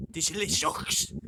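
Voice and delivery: loud, drawn-out mangled "Delicious"; "Delicious" overblown, slow and loud; spelling pronunciation. Shown as a demonstration. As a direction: belt out "Delicious" slowly, as if sounding out the spelling. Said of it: "that's how it looks like it's pronounced".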